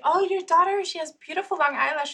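high, gushing compliment; gushing stranger. Retold or imitated. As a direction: coo over the child like an admiring passer-by. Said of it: "they would say".

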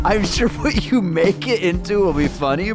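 ordinary talk, nothing marked

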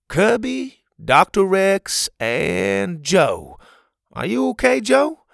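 name them, then worry over Joe; Pa's voice. Acted. Pa counts counselors and asks after hurt Joe.

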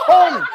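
high and loud echo of the wrong bark; mock-incredulous hong. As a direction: throw the silly bark back. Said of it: "what dog goes hong".

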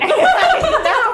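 shrieking, loud burst of laughter; loud high laugh. Real, not acted. Big laugh at friend's answer.

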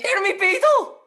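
high, loud burst of disbelief; amazed high-pitched outcry; real astonishment. Real, not acted. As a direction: exclaim the name, stunned, rising at the end. Amazed at the relative's name.

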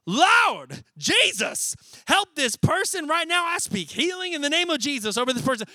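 loud, high, fervent healing prayer; impassioned preacher's plea to heaven; intense prayer. Acted. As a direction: pray hard, as if a life depends on it. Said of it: "That's some intense prayer".

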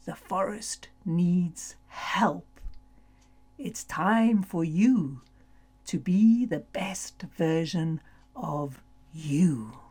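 serious voice. Acted. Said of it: "said in a serious voice".

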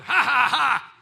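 loud hearty ha ha ha; Ha, ha, ha as a loud staged laugh; mock laugh. Shown as a demonstration. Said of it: "That's like us going".

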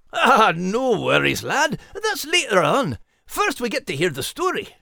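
high Scottish-pirate lad voice; reassuring with a teasing grin; Max voice. Acted. Max reassures the announcer, high Scottish lilt.

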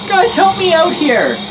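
put-on very high, loud child's squeal; kid calling for help. Game master as the kid calling his Pokemon, squealed plea. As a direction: cry out as a panicking little boy.

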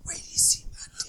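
breathy seductive whisper. Acted. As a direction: whisper it creepily close. Whispered bit repeated.

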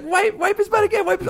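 high little-girl voice, imitated; toddler voice. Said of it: "And she's like".

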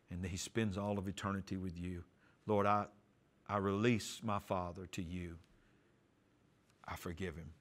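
soft, low, fading earnest prayer; hushed prayer. Retold or imitated. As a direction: pray it under your breath. Praying at the doorknob.

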